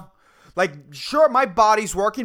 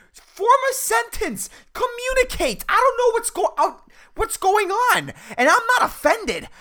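high-pitched comic exasperation; exasperated rant; 10 semitones higher than usual. Acted. Barista begs customer to communicate clearly, exasperated.

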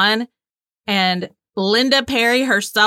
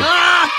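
higher, louder playful shriek; mock scared yelp; 9 semitones higher, 4 dB louder than usual. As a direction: squeal as if frightened, half joking. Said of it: "I'm so scared".

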